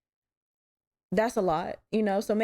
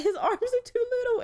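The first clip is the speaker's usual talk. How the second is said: high-pitched imitation of breaking down; mock breakdown. Host retelling breaking down over little arms, mock-wailing high. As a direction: squeal it out in a mock tearful wail. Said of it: "I just broke the fuck down".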